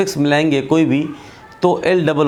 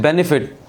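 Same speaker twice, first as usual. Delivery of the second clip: Benefit with weight on BEN, light falling -fit; unstressed ending. Shown as a demonstration. Teacher demonstrating an unstressed ending, benefit as BEN-i-fit. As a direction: let the end of benefit drop away unstressed. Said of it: "ये unstress है".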